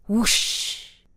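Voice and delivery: ordinary delivery, nothing marked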